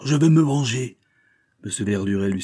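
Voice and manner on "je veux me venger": high, loud cry while struggling; struggling shout. A narrator's reading. Banker struggles against Verduret, high loud cry. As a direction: fight to get free. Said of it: "en se débattant".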